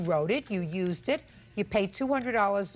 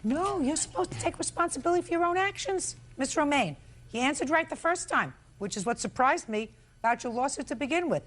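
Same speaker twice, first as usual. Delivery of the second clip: scolding; 6 semitones higher than usual. Real, not acted. Judge scolds plaintiff.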